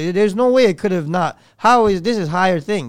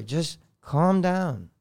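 father's calm advice; 5 semitones lower, 7 dB quieter than usual. Retold or imitated. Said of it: "I heard my father".

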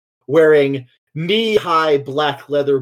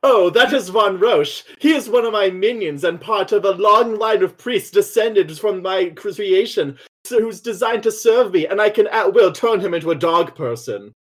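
higher, theatrical villainess boasting of her minion; imperious villainess; 6 semitones higher than usual. Acted. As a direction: play a grand mistress showing off a servant. Voices villainess describing her minion.

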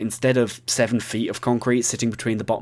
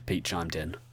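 ordinary delivery

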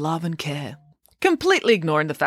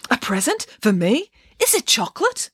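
put-on excited child voice; 7 semitones higher than usual. Bramwellio excited by present.

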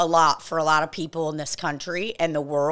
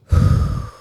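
long loud breathy sigh out; loud exhale; 6 dB louder than usual. Real, not acted. Deep breath during the breathing session, forceful exhale. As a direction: blow the breath out hard.

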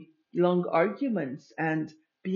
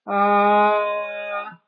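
tight ah pushed from the throat; 3 semitones higher, 10 dB louder than usual. Shown as a demonstration. Speaking coach showing the throat voice, tight ah. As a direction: squeeze the ah through the throat. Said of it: "that's the maximum I can go with my throat".

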